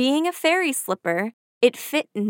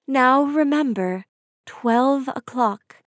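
lightly voiced fairy godmother; gentle warning from a godmother; 3 semitones higher, slower than usual. A narrator's reading. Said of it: "her godmother had warned".

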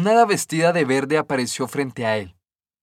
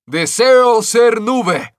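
much higher, louder, slow angry cry; irritated shout; 8 semitones higher, 8 dB louder, much slower than usual. A narrator's reading. The angry sun shouting at the sky, slow furious yell. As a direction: bellow the wish in irritation. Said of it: "se irritó ... y gritó".

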